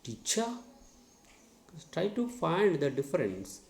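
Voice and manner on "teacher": "Teacher" with cha lifted in a rising tune; second-syllable stress. Shown as a demonstration. Teacher shows the wrong stress, "Teacher" rising on cha. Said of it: "If I stress the cha".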